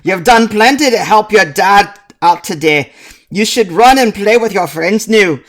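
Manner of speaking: loud, high dwarf brogue; fatherly dwarf shooing his child out to play; hammed-up dwarf accent, pushed high and loud; Grisbeck Hearthstone. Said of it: "says Grisbeck".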